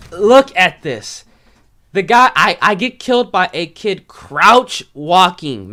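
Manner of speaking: fed up with a cheap death; exasperated. Real, not acted. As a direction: drag it out in disbelief. Angry at dying to crouch walker.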